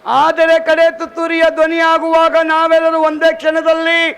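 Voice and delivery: loud, high proclamation, slowing on the last words; thundering the apostle's words to the congregation; preaching scripture. Real, not acted. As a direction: call out the verse like a herald. Reading verse in preaching voice.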